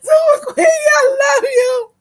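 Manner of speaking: high, loud, slow laughing cry of praise; ecstatic shout. Real, not acted. To a viewer in the chat, shrieking with delight. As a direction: scream the praise out.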